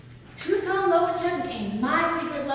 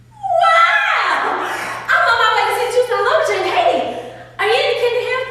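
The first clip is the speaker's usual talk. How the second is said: high, loud, fast and laughing; thrilled; 9 semitones higher, 8 dB louder, faster than usual. Retold or imitated. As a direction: burst out with breathless delight. Girl thrilled at the name.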